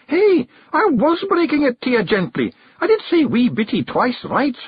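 very high Scottish Feegle voice, protesting; Rob Anybody, indignant. Acted. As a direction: defend yourself as a tiny indignant Scot. Rob defends his gentle manner.

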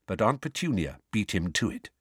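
usual speaking voice

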